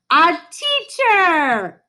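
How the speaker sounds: high, loud, stretched shout; all the kids yell it out together; class shouting. A narrator's reading. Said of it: "everyone responded".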